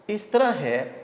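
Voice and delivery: ordinary talk, nothing marked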